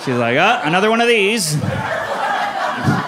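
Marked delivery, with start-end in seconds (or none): 0.4-1.6 high, loud mock exclamation; hammed-up cry on the way down; falling mom. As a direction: imitate mom calling out mid-fall. Mom reacting to her own fall.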